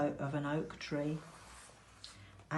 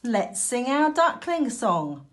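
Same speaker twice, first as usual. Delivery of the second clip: calling out; 6 semitones higher, 5 dB louder than usual. A narrator's reading. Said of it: "Mother Duck called".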